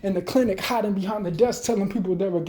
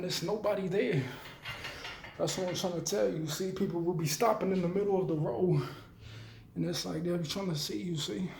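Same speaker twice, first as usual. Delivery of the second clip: muttered aside; 2 semitones lower, 10 dB quieter than usual. Real, not acted. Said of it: "aside while driving, softer".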